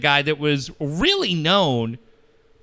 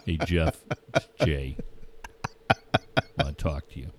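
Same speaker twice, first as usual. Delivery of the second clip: much lower, slowed mock caller brushing someone off; put-on caller voice; 14 semitones lower, 7 dB quieter than usual. Host mimics a gruff caller told to get lost, low put-on voice. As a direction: drop way down like a grumpy guy on the phone.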